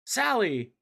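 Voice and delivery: a hammed-up shout for the fallen friend; calling Sally. Recapping the comic, Sonic cries out as Sally falls.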